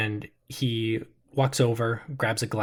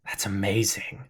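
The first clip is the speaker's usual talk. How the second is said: awed whisper; 3 semitones lower, 4 dB quieter than usual. Acted. Awed at flame in palm.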